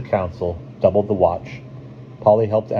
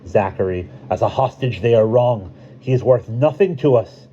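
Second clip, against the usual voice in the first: higher, louder dismissive character voice; Tav's indignation; 5 semitones higher, 4 dB louder than usual. A narrator's reading. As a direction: build to a forceful dismissal. Tav's angry objection in council.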